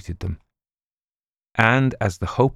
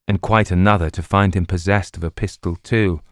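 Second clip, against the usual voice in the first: ordinary delivery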